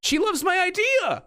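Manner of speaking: high, loud gleeful shout; excited shout. Real, not acted. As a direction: shout with delight.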